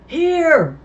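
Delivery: high, very loud cry; trapped man shouting back to the boys. Acted. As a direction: yell it out from under the car. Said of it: "Lavender shouted".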